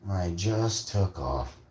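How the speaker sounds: slow, stretched-out emphasis on "just"; slow emphatic repeat. Real, not acted. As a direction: draw it out for effect.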